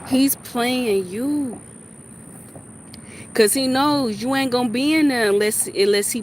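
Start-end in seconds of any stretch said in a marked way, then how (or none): 0.1-1.6 slow, drawn out. Real, not acted.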